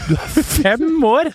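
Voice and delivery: high, drawn-out disbelieving question; incredulous. Retold or imitated. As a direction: retell it in exaggerated shock. Gjenforteller sjokkert reaksjon på barn. (sjokkert, lyst og utdratt)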